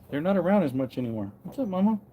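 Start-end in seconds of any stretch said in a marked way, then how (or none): 1.4-2.0 pet talk. Real, not acted. Greeting a donkey.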